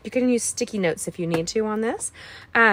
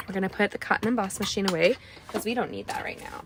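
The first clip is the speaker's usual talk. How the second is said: whisper; 5 dB quieter, faster than usual. Real, not acted. Whispered aside while tidying tools.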